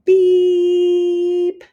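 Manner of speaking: high loud alarm beep; screamed beep. Acted. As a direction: blare it like an alarm. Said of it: "Splat jumped and screamed".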